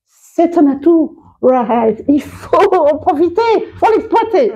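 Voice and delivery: high-pitched, rousing pep talk; enthusiastic urging. Acted. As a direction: urge them on, pitch climbing with each phrase. Urging use of the accent.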